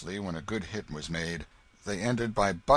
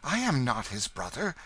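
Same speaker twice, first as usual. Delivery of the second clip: firm, higher denial; interrupting; 6 semitones higher than usual. A narrator's reading. The King breaking into the stranger's request, interrupting. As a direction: cut in to disown him. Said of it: "said the King, interrupting".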